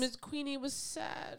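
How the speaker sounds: high, drawn-out mock sympathy; mock pity. Co-host teasing about the queen being saddened, mock pity. Mocking the queen's reported sadness.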